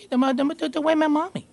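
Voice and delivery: lightly voiced little boy stammering; toddler voice. Grandpa imitating the boy asking for his mommy, softly. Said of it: "He'll look at you".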